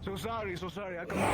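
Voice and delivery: high apologetic voice. Acted. Character voice apologizing.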